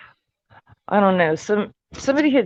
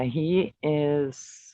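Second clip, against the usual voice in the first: normal talking voice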